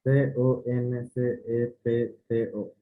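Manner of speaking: letter by letter, evenly spaced; spelling it out. Shown as a demonstration. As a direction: spell each letter out separately.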